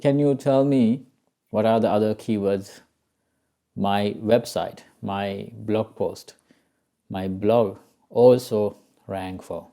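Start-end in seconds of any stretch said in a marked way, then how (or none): none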